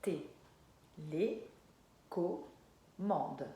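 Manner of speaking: TÉLÉCOMMANDE very slow, long pauses between TÉ, LÉ, CO, MMANDE; syllable by syllable. Shown as a demonstration. Teacher letting the child hear each sound, syllable by syllable. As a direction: sound out TÉLÉCOMMANDE one isolated syllable at a time. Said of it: "Quelle est la première syllabe?".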